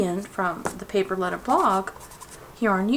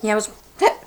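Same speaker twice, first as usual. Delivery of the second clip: hiccup; 4 dB louder, much faster than usual. Real, not acted. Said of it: "I hiccuped".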